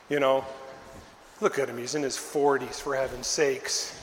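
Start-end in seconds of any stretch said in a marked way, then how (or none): none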